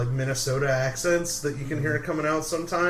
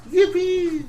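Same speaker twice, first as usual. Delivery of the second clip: put-on much higher, laughing kid cheer; young Anakin; 4 dB louder than usual. Echoing the Anakin joke to friends, a high laughing yippee. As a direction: squeal it like little Anakin. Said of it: "It's like a Yippee accent".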